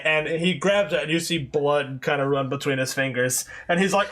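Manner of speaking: usual speaking voice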